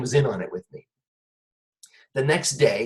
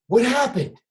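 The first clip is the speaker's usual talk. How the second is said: higher, put-on innocent surprise; mock concern; 10 semitones higher than usual. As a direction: play dumb, pitched up and curious. Feigning concern to fooled cellmate.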